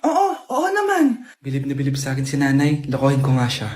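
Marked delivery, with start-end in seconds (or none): 1.4-3.7 inner thought aside. Acted. Inner thought voice, much lower.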